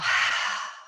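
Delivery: quick forceful breath out through the mouth; sharp exhale. Shown as a demonstration. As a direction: push the air out in one sharp burst. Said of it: "and exhale".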